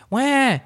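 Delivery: high mock toddler cry; a quick hammed-up wail of a fussing child; imitated baby wail. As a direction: mimic a baby starting to cry. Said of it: "she started, like".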